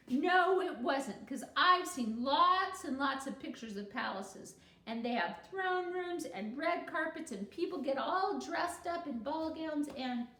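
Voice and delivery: put-on loud, eager child arguing; boy arguing his case to the class; insistent protest. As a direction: pile on the reasons breathlessly. Barry protesting, louder.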